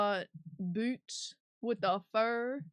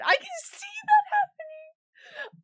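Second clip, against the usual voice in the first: a sudden high, loud squeal of delight; excited squeal; 10 dB louder, slower than usual. Real, not acted. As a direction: shriek with real excitement. Excited squeal at chat idea.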